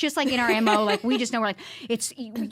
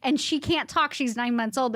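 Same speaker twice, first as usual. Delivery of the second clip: usual speaking voice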